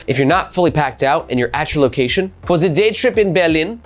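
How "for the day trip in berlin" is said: put-on German accent. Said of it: "my German accent is not my best accent".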